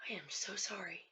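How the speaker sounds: very soft, sorry apology; barely above a breath, deeply apologetic; hushed apology. Acted. Player to Wyatt after wounding him, very soft and remorseful. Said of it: "and I'm like".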